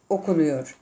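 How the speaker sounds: usual speaking voice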